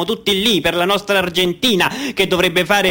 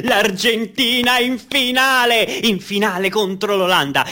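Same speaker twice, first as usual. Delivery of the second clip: radio commentary; 5 semitones higher than usual. Acted. Radio commentator describing the packed stadium, excited. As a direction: build the crowd's excitement like live radio.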